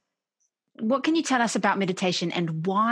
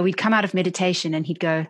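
normal talking voice

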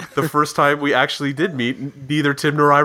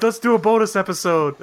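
mock excited friend; 7 semitones higher than usual. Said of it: "You were like".

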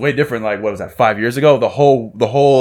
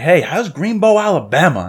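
eager question; 7 semitones higher, slower than usual. Retold or imitated. Eager question about Forrest Gump town.

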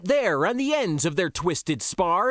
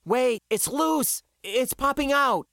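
high, alarmed call; raised voice, pitched up; 8 semitones higher than usual. A narrator's reading. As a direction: call out a problem urgently. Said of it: "she called".

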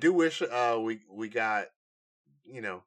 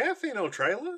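mock clueless moviegoer; 12 semitones higher, faster than usual.

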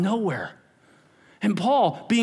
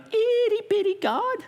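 much higher squeaky mock voice; mock tiny voice; 14 semitones higher than usual. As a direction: make it sound tiny and silly. Mocking tiny God.

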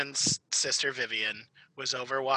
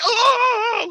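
high, loud imitated wailing "Oh"; mock wail; 10 dB louder than usual. Imitating the sister wailing at the movie's end. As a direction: wail it out like someone sobbing. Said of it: "like, wailing".

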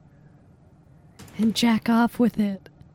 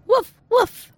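a higher, louder woof woof; barking like a dog; 9 semitones higher, 4 dB louder than usual. Shown as a demonstration. Demonstrating to Ted how a dog should bark, higher and louder. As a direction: show him a proper dog bark. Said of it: "Go be like".

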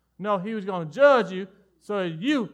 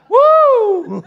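burst of laughter; 14 dB louder than usual. Real, not acted.

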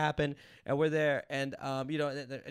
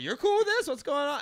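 high-pitched, louder retold astonishment; startled disbelief; 11 semitones higher, 7 dB louder than usual. Guest telling how he asked if her husband was cool, startled disbelief. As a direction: blurt it out high, acting out the surprise. Retelling his startled reply to the wife.

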